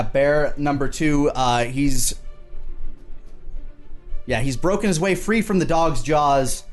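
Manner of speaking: usual speaking voice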